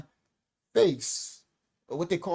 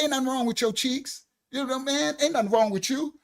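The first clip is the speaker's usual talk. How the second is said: higher mock doctor voice, brushing it off; doctor dismissing; 8 semitones higher than usual. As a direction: play the doctor waving it away, pitched up. Doctor voiced in retold story.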